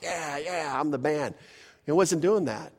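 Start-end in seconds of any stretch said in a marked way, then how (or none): 0.0-1.3 soft, lazy boast with a hint of swagger; mock bragging athlete. Pastor lightly mimics a boasting NBA player, smug. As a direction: toss off a mild mock brag. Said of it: "it's kinda like".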